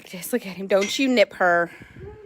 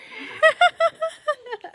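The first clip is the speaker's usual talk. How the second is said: high laugh. Real, not acted. Laughs at own joke.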